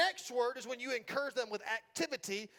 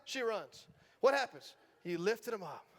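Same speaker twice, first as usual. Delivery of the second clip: normal talking voice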